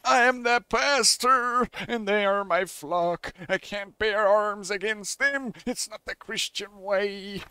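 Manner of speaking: slow, drawn-out preacherly plea; hammed-up earnest clergyman; pleading priest. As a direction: protest as a pious pastor, stretching the words. Voices priest of the church.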